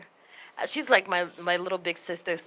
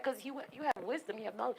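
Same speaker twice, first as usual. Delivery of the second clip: ordinary talk, nothing marked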